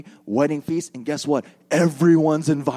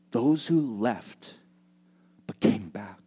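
slow, soft, ending in a whisper; hushed; 6 semitones lower, 6 dB quieter, slower than usual. Real, not acted. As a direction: let it drop to a whisper. Tender point of sermon.

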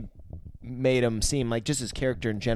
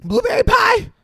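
much higher, louder disbelieving yelp; incredulous; 7 dB louder than usual. Real, not acted. Friend echoing the silly movie line in loud disbelief. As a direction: squeal it back in disbelief.

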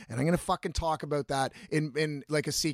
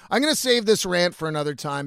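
punched opening that settles as it goes; firm announcement; 4 dB louder than usual. Real, not acted. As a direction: announce the decision out loud to your audience. Restates the decision to the audience.

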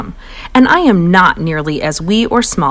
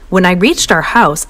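usual speaking voice

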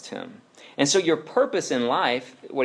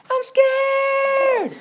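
very high, loud scared squeal; mock child's scream; 6 dB louder than usual. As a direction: shriek like a frightened little boy.

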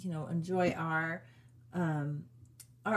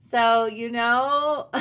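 laughing; 3 semitones higher, 6 dB louder, much slower than usual. Real, not acted.

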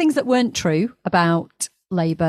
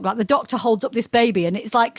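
ordinary talk

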